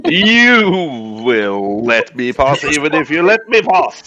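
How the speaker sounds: very slow, drawn-out mock command; hammed-up entitled demand. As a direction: stretch it out like a pompous gatecrasher. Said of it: "Full Karen".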